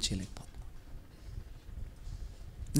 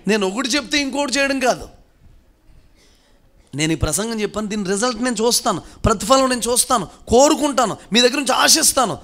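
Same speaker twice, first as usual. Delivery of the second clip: higher, louder, building pulpit demand; full-force preaching; 4 semitones higher, 6 dB louder than usual. Acted. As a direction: drive the expectation home. (బలంగా ప్రసంగం)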